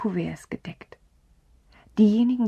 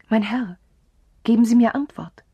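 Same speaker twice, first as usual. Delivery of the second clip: loud, higher desperate cry; 4 semitones higher, 7 dB louder than usual. A narrator's reading. Countess crying out to the narrator for an answer. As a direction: demand an answer, voice rising loud. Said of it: "rief sie".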